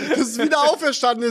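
laughing high and fast. Real, not acted. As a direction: burst out laughing while saying it. Laughing retry over the chicken. (lachend, hoch und schnell)

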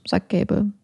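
plain reading voice

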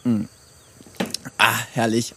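satisfied sigh after a swig; just swallowed a drink; while sipping a drink. Real, not acted. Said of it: "Moment, Trinkpause".

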